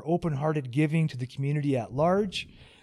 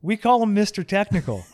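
mock teasing; 5 semitones higher, 4 dB louder than usual. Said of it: "I'm kidding".

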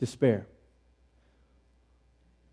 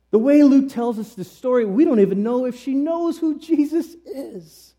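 raised, high-pitched protest; high emphatic insistence; 7 semitones higher than usual. Real, not acted. Preacher disputing that the widow had faith, high insistence. Insisting, then restated more calmly.

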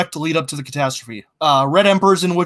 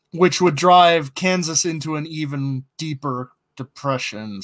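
normal talking voice

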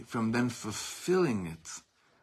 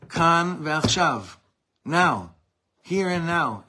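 loud recital; 6 semitones higher, 8 dB louder, slower than usual. Acted. Answering with defiant declaration.

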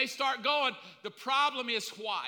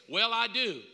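ordinary talk, nothing marked

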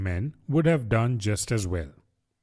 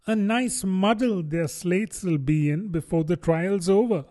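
lightly voiced young girl, higher; wishful young Alice, a touch higher; a hint of a hungry little girl; Alice thinking; 6 semitones higher than usual. Alice's thoughts.

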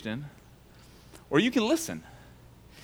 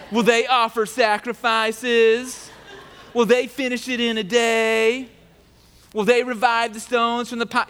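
mocking taunt voice; 6 semitones higher, 3 dB louder than usual. Sanballat taunts the builders with a scornful voice. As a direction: ham it up like a heckler. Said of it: "stop the voice".

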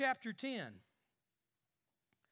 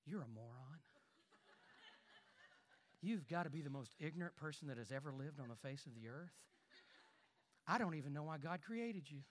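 quiet, gentle voice carrying harsh words; soft-spoken insult; 5 semitones lower, 8 dB quieter, faster than usual. Shown as a demonstration. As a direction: say the cruel words softly and sweetly. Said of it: "you can be very soft and say".

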